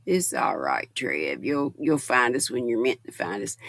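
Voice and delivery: reassuring. Real, not acted. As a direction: comfort him gently. Reassuring a viewer.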